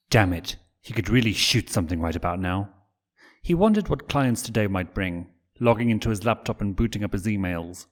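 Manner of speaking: usual speaking voice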